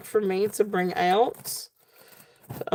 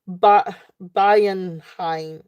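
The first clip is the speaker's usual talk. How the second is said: Bienheim said with a put-on German accent; much slower than usual. Shown as a demonstration. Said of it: "I'm giving it a German accent".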